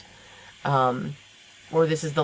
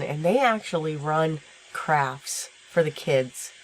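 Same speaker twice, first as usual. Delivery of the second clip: ordinary talk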